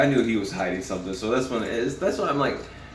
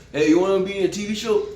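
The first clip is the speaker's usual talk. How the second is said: eager casting scout; 10 semitones higher, 5 dB louder, faster than usual. Pretend. Casting agent recruiting on corner.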